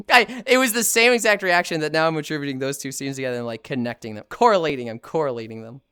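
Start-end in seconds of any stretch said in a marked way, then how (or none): none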